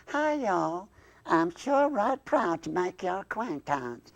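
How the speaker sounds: slow, swooping Southern drawl; high-pitched, stretched Southern puppet greeting; Southern accent. Acted. Southern dummy greets new acquaintance, slow Southern drawl. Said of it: "that accent".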